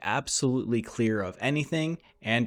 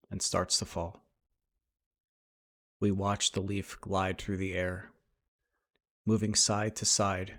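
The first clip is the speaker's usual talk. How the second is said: guided meditation; 5 dB quieter than usual. Acted. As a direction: lead them gently through breath and imagery, unhurried.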